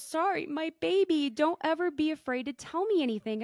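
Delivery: tender mother. Retold or imitated. Mother comforting her son after his confession, tender. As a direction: gently reassure your son. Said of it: "she goes".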